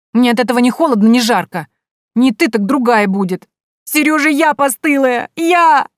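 high, loud wailing rising to a cry; tearful wail. A narrator's reading. Jilted girl wailing to the heroine, high and tearful. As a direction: wail it out through tears.

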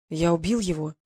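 low, somber admission; grim. Acted. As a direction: say it heavy and dark. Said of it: "мрачно произнёс я".

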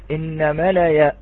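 ordinary talk, nothing marked

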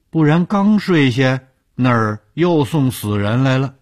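put-on old man's reply, climbing high and loud; old morgue keeper. A narrator's reading. Old morgue keeper voiced in story.

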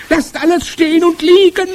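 shrieked order, very high and loud; piercing scream. A narrator's reading. Witch orders the whole ballroom to freeze, screaming. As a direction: blare it out like a trumpet. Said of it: "Ihre gellende Stimme scholl wie eine Trompete".

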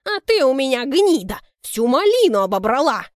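loud, scornful neighbour; angry insult. Acted. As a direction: spit the insult out.

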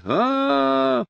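long, very high wail; high sustained "aaah" as if from afar; distant wail. A narrator's reading. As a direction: wail on one high held note. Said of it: "вопил Квадриго уже где-то вдалеке".

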